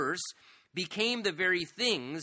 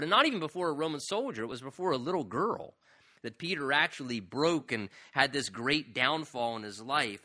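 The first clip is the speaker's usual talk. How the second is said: ordinary talk, nothing marked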